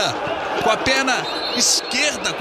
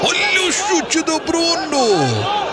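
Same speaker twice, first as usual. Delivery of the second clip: excited replay call; 6 semitones higher, slower than usual. Real, not acted. Replay of shot.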